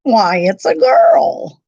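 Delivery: loud, slow exclamation; cried out. A narrator's reading. Doc finding a girl in bed, loud surprise. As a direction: call out in surprise, drawn out. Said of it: "Doc cried out".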